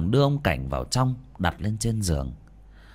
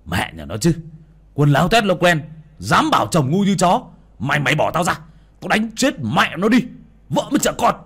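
high, loud angry shouting; furious cursing; 8 semitones higher, 6 dB louder than usual. Acted. Husband threatening to beat the one holding him, furious shouting. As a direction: curse and threaten at full volume.

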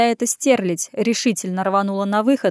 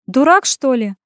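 higher, louder exasperated woman; blurted jibe; 5 semitones higher, 5 dB louder than usual. A narrator's reading. Woman reacting to his confession, incredulous outburst. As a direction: blurt it out in disbelief. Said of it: "выпалила Иренка".

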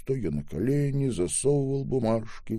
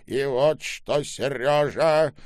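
stern; 5 semitones higher, 5 dB louder than usual. A narrator's reading. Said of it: "строго произнёс старик".

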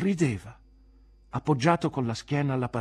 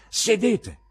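high, loud order to a pupil; stern teacher's shout; sharp command; 13 semitones higher, 9 dB louder than usual. Acted. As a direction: order him down at once.

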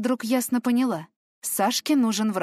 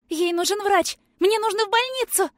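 much higher, much louder panicked yelling; frantic screaming; 13 semitones higher, 4 dB louder than usual. Acted. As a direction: shriek it out, begging for a doctor. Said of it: "Я так билась и кричала".

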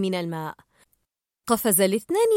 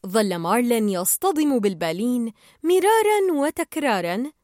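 ordinary delivery

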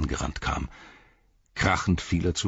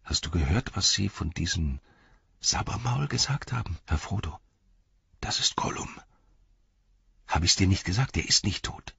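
whispering; 3 semitones higher than usual. Acted. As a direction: whisper urgently to your master. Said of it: "diesmal flüsternd".

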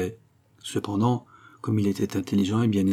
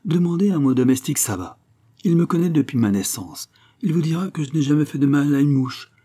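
higher, louder, eager joy; eager young count, joyfully pleading his case; 6 semitones higher, 4 dB louder than usual. A narrator's reading. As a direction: rush to reassure them, brightening. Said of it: "avec une sorte d'empressement joyeux".

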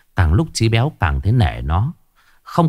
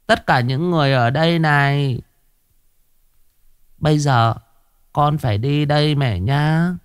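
put-on gentle ghost child farewell; 6 semitones higher than usual. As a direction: promise blessings while leaving. Ghost son's farewell promise.

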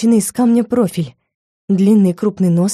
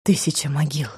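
much softer, lower mutter; muttered oath; 3 semitones lower, 7 dB quieter than usual. A narrator's reading. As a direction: say it under your breath. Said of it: "пробормотал он себе под нос".

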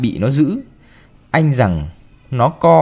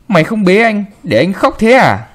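loud, higher mistress's scolding; angry scolding; 6 semitones higher, 5 dB louder than usual. A narrator's reading. Mistress storming in at the maid, loud and angry. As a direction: tear into the girl.

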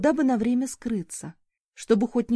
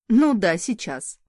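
slow, scornful, louder sneer; mocking; 7 dB louder, slower than usual. A narrator's reading. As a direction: toss it off with open mockery. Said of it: "насмешливо бросила".

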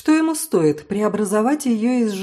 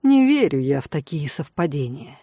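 usual speaking voice

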